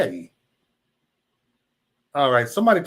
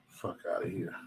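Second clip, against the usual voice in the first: whispered under the breath; whispered aside; 9 semitones lower, 16 dB quieter than usual. Real, not acted. Whispering to himself while reading chat. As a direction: drop to a whisper, annoyed.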